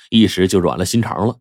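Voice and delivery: plain reading voice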